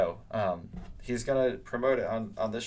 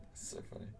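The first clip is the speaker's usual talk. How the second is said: whispering; 16 dB quieter, faster than usual. Real, not acted. Reacting to chat, whispered.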